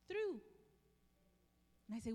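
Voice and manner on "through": through said high and loud, drawn out; the single word through, high and ringing; emphatic word. Retold or imitated. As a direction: land hard on through. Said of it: "She said".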